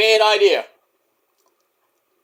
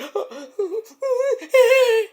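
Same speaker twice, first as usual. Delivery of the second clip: mock gasping sob. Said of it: "crying up like a bitch".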